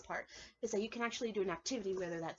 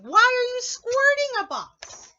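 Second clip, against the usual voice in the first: much higher, loud and slow asking; loud, high storybook question; 14 semitones higher, 14 dB louder, slower than usual. Acted. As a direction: call the question out slowly. Reading question aloud.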